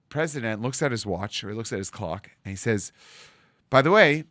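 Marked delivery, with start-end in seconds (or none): 3.7-4.2 a louder, higher "By the way" that cuts in; firm interruption. Acted. Bank president interrupting the loan meeting, firm and louder. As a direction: break in with "By the way" as if suddenly remembering.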